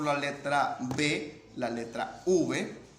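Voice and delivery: la letra B, la letra V spelled out slowly; name each letter slowly, like dictation; slow teacher's letter-by-letter naming. Shown as a demonstration. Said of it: "al fonema solo existe uno".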